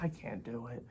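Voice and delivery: muttered low and soft, defeated; quiet give-up. Retold or imitated. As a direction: mumble it under your breath like giving up. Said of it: "I'm like".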